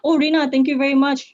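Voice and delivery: ordinary talk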